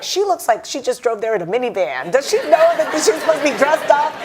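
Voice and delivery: a hint of teasing teenagers; teen kids. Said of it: "They're like".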